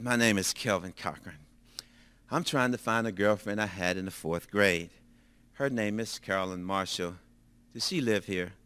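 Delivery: low, soft, deflated retelling of the call; enthusiasm gone. Retold or imitated. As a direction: go through the motions with no hope left. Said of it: "my enthusiasm was gone".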